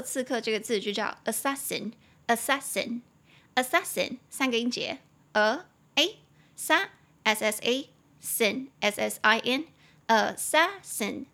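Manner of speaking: assassin broken into a, sa, sin, spelled very slowly; syllable-by-syllable spelling. Shown as a demonstration. Teacher spelling out assassin syllable by syllable for students. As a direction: drag out each syllable of assassin, then spell it letter by letter. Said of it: "中音节在第二音节，assassin".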